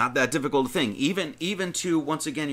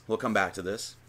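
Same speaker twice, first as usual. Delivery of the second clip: softer, lower repeat of We'll come back to this; quiet aside; 5 semitones lower, 6 dB quieter than usual. Real, not acted. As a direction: mutter the repeat to yourself.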